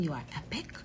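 whispered, tender; soft sincere whisper. Real, not acted. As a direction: breathe it softly to the fans. Whispered compliment.

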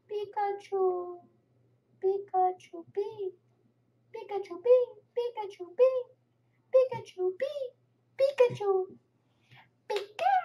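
high, squeaky creature chirp; cute high cartoon creature call; Pikachu impression. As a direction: chirp the name over and over, high-pitched.

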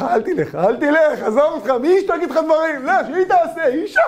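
high, loud coaxing voice; tempting evil inclination. Acted. Evil inclination urges husband not to get up, high coaxing. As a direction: coax him to stay seated.

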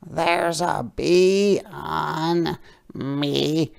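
high, slow storybook duck. A narrator's reading. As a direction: complain slowly as a put-upon duck. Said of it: "said the duck".

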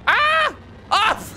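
sudden high yelps of shock; startled scream. Real, not acted. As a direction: yelp as the car goes wrong. Car crashes at launch.

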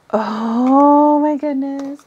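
slow, breathy swell of amazement; breathy astonishment. Real, not acted. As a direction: draw it out slowly, breath rushing through. Discovers the next item.

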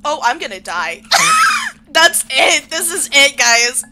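a loud wail half laugh, half despair; laughing panic shout. Real, not acted. Character about to die in game.

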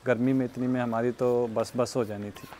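usual speaking voice

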